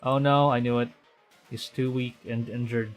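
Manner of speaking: normal talking voice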